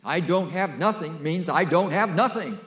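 loud, high, delighted emphasis; booming emphatic double negative; emphatic Greek double negative. Shown as a demonstration. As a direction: proclaim it with joy. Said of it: "In Greek, that's beautiful".

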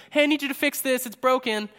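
pestering request. Retold or imitated. Someone greeting you back with a problem, high and insistent.

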